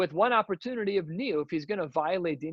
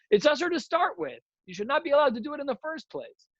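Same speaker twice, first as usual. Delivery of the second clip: much higher, pressing the point hard; emphatic objection; 11 semitones higher than usual. Real, not acted. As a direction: insist it should be forbidden from the start.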